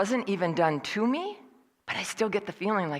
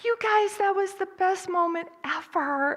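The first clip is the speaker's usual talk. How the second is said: high, squealed imagined gush; imagined over-the-top excited interruption; gushing outburst; 13 semitones higher than usual. Pretend. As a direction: burst in squealing with delight, drawn out. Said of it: "the Holly in me wanted to jump in and go".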